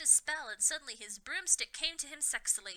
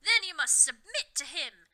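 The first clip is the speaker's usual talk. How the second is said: menacing; 4 semitones higher, 7 dB louder than usual. A narrator's reading. Snape threatening the students, loud menacing cry. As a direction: menace them like a furious henchman. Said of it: "Snape ejaculated menacingly".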